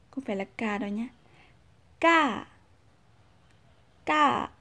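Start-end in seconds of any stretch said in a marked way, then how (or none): 2.0-4.5 ca said high and a bit louder, not falling like cà; high Lao tone. Shown as a demonstration. Teacher modelling the Lao tone for learners, high pitch. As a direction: lift ca up high, not the cà fall. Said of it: "cách phát âm cho nó chuẩn".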